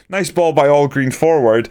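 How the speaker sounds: ordinary delivery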